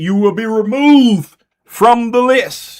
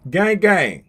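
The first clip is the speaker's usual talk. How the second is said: ordinary talk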